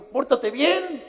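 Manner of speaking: high, drawn-out mock reprimand; mock stern scolding. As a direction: ham up the stern husband laying down the law. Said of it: "porque te digo".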